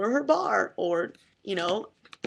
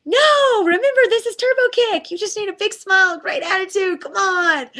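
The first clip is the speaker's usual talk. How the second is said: much higher and louder, bubbly fitness-coach cheer; peppy instructor call; 10 semitones higher, 5 dB louder than usual. Retold or imitated. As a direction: sell it like an aerobics instructor with a huge smile. Instructor steering student away from weights.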